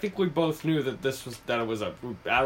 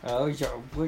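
ordinary delivery

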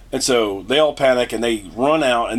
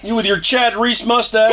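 mock insult; 8 semitones higher than usual. Mocking the trucker's mustache, higher mock shout. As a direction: jeer at his mustache.

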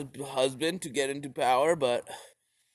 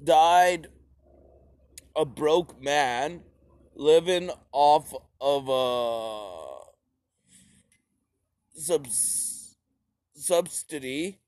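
ordinary talk, nothing marked

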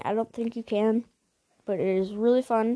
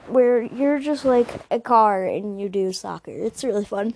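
normal talking voice

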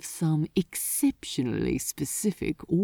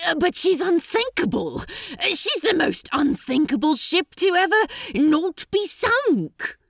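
fast, much higher, flustered protest; panicked; 10 semitones higher, 3 dB louder than usual. Acted. Crowley protesting that the ship is unsinkable, panicked and fast. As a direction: babble it out in a panic. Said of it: "in his panic and horror".

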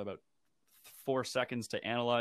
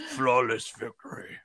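booming fighting game announcer; 3 dB louder than usual. Retold or imitated. Quoting Mortal Kombat announcer.

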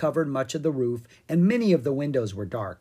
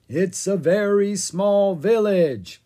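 slow, loud, higher zombie drone; 6 semitones higher, 6 dB louder, slower than usual. A narrator's reading. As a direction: drone it like the undead. Ella keeps zombie act.

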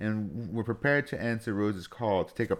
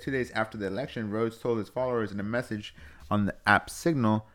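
ordinary talk

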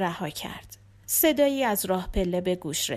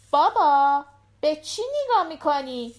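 put-on high-pitched little girl chatting to her dad; little daughter; 6 semitones higher, 4 dB louder than usual. A narrator's reading. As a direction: voice a young daughter, pitched well up. Narrator voices the daughter asking. (صدای زیر دختربچه)

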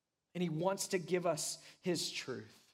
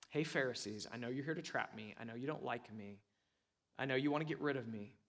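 lower, softer acted quote; quiet confiding; 6 semitones lower, 5 dB quieter, faster than usual. Jesus telling the Pharisees he knows their trap, quietly. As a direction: voice Jesus in a hushed, low register.

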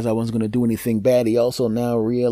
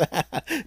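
high laugh; 11 semitones higher, 5 dB quieter than usual. Real, not acted. Own laugh at joke.

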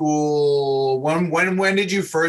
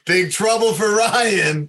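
slow, high mock-ominous announcement; drawn-out, raised-pitch ribbing; playful teasing; 6 semitones higher, slower than usual. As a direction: tease him as if he's busted. Host teases guest mid story.

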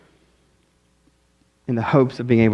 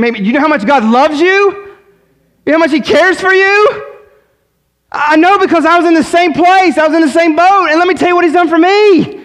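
calling out; 13 semitones higher, 5 dB louder than usual. Pretend. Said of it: "we would scream".

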